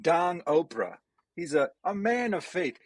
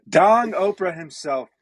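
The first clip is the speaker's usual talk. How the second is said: "Dong Oprah himself" called out loud like a ring announcer; announcer hype; 4 semitones higher, 7 dB louder than usual. Acted. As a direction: boom the name out as a big intro, louder. Hyped intro of the guest.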